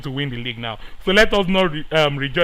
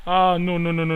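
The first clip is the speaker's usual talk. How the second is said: usual speaking voice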